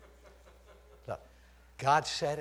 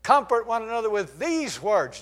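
"Comfort one another with these words" loud and higher, stress on "these"; ringing preaching; 8 semitones higher, 8 dB louder than usual. Shown as a demonstration. Preacher repeating the verse to the church, ringing loud. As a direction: proclaim "these words" to the room. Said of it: "Do you know what your Bible says?".

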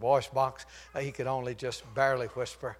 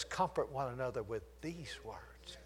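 softer, hushed reading of "Comfort one another with these words"; soft scripture reading; 9 dB quieter than usual. Shown as a demonstration. Preacher quoting the Bible softly to the church, hushed. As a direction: read "Comfort" gently, almost murmured. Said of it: "Do you know what your Bible says?".

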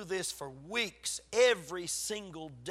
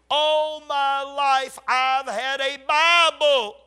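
high, loud, drawn-out preaching cry; loud proclamation; 6 semitones higher, 9 dB louder, much slower than usual. Real, not acted. Preacher telling congregation he always had a Bible, loud proclaiming. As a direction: proclaim it slowly at full force.

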